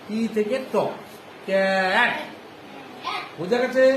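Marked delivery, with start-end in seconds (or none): 1.5-2.1 ক্যাট in one piece with a held "ae"; blended cat. Shown as a demonstration. Teacher joins three sounds for pupils, blended cat. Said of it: "সি থেকে ক".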